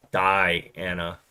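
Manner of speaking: DIANA sounded out slowly as die-ANNA; drawn-out name. Shown as a demonstration. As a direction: split DIANA into two parts, low. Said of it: "That sounded weird".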